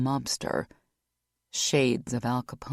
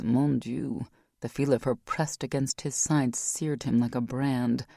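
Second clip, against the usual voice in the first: usual speaking voice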